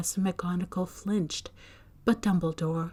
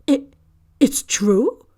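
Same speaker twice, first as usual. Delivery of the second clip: broken start, louder and trembling; faltering; 6 semitones higher, 8 dB louder, slower than usual. A narrator's reading. McGonagall asks Dumbledore in disbelief, faltering. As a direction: she can barely get the words out. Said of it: "faltered Professor McGonagall".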